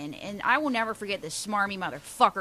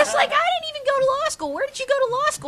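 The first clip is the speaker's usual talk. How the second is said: 1 high mocking; 3 dB louder, faster than usual. Real, not acted. Mocking the lawyer.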